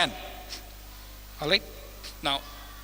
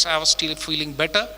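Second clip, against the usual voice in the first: ordinary talk, nothing marked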